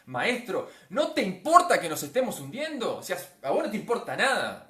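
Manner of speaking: loud, high-pitched accusation; reproachful. Acted. Disciples accusing their master of not caring, reproachful. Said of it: "Es un reproche diciendo".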